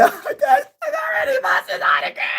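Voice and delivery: high, squealing pretend sob; overjoyed fake tears, voice cracking up high; mock crying. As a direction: act out bawling with joy. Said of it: "I'm like".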